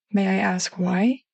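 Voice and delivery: ordinary delivery, nothing marked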